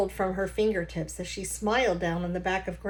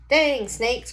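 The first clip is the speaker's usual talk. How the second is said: put-on higher, louder folksy old grandpa; old Gramps voice; 4 semitones higher, 6 dB louder than usual. Grandpa poking at a stranger's engine, confident folksy voice. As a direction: play the know-it-all grandpa tinkering.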